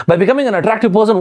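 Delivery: fast, high-pitched baffled repeat; puzzled echo. Acted. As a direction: echo it quickly like a confused listener. Puzzled listener echoes the statement.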